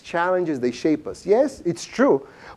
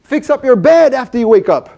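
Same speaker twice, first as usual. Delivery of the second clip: mock scolding, higher and louder; a wife nagging about the bed, lightly acted; wife scolding her husband over the unmade bed; 5 semitones higher, 4 dB louder than usual. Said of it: "his wife says to him".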